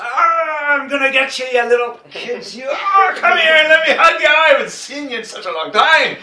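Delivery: loud, high Scottish-Irish warrior greeting, half shouting; Irish or Scottish accent. Retold or imitated. Warrior home at last greeting his kids in a loud Irish or Scottish accent. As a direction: boom it out in a big Scottish or Irish brogue as he hugs the kids. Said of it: "the Irish or the Scottish accent makes it all the better".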